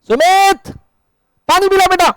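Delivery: high called-out name across the room; calling out. Real, not acted. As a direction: summon Sumit from the back of the class. (ऊँची आवाज़ में सुमित को पुकारना)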